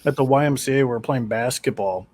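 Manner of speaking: ordinary talk, nothing marked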